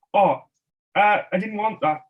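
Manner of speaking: high, loud fake-innocent whine; mock complaint. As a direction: pretend you got the wrong thing. Kids tormenting shopkeeper, fake complaint.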